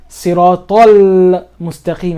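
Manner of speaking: slow Siratal Mustaqim with a long l; lam pronounced. Shown as a demonstration. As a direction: hold the l ending Siratal before Mustaqim. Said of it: "I'm still pronouncing the lam".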